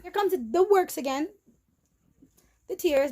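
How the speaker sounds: fighting back a rush of tears; tears welling up. Real, not acted. Tears coming while recounting.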